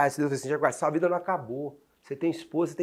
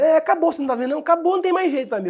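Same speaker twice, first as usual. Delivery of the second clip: high, pleading retold lament; despairing cry; 10 semitones higher, 5 dB louder, faster than usual. Man retelling his despair to God, high desperate cry. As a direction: cry out that it's all over, voice pitched up high.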